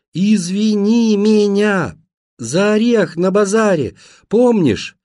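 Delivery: high, loud, slow syllables; loud, over-articulated apology; very distinct shout. A narrator's reading. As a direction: shout each word clearly into a deaf ear. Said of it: "Стараясь произносить слова максимально чётко, я закричал Вовке в ухо".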